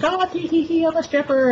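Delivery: very high squeaky giggle voice; ditzy tee-hee, mocking her; giggly bimbo stripper. Mocking the stripper's giggly introduction, very high and squeaky.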